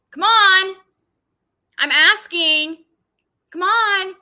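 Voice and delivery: loud, high hammed-up begging; mock pleading. Mocking the panel invite, loud high hammed begging.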